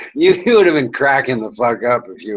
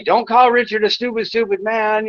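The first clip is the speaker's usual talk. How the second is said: fast, high imitation of a taunting troll; high mock troll whine; 8 semitones higher than usual. Streamer imitating the troll's message, high and fast. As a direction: rattle it off in a high silly voice.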